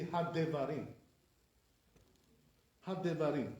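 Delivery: Hadevarim as had-de-va-RIM; sounded sheva. Shown as a demonstration. Teacher showing correct reading, Hadevarim with sheva sounded. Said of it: "It should be".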